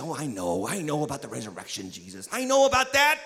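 low, soft know-it-all murmur lifting at the end; all theological. Acted. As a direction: act out Martha showing she already knows. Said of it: "Martha gets all theological".

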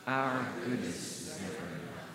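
the congregation repeats it quietly with eyes closed. Shown as a demonstration. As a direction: say it inwardly, hushed. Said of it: "close your eyes and say it".

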